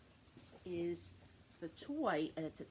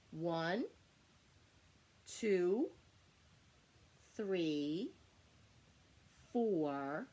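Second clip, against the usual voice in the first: put-on kids show host; much slower than usual. Shown as a demonstration. Counting Y's aloud with child.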